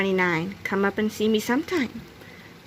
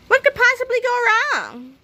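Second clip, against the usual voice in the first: very high, carefree rhetorical question; breezy overconfidence; 14 semitones higher, 4 dB louder than usual. A narrator's reading. George waving away Harold's worries, breezy overconfidence. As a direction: toss it off high and cocky.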